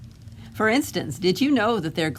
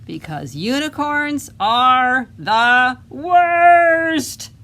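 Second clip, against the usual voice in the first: louder, slow, drawn-out proclamation; grand declaration; 5 semitones higher, 9 dB louder, much slower than usual. Acted. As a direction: proclaim it slowly like a battle cry. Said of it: "wanna say that with me".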